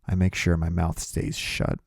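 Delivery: plain reading voice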